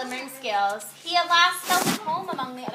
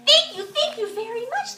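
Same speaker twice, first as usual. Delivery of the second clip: high, playful little fish thanks; bubbly thanks; 9 semitones higher, 3 dB louder than usual. A narrator's reading. Little blue fish thanking the rainbow fish, high and playful. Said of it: "bubbled playfully".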